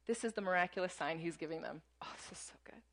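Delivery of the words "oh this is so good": whisper. Real, not acted. Aside before reading Jesus' answer.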